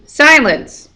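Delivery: sharp, loud, high snarl; snarling command. A narrator's reading. Medusa silencing the girl, angry snarl. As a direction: cut her off with fury. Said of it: "Medusa snarled".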